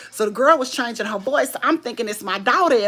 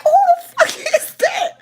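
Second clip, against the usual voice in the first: very high, louder, hammed-up disbelief; mock outraged surprise; 6 dB louder than usual. Son overhearing the caller, mock outraged surprise. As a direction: squawk it like a shocked son.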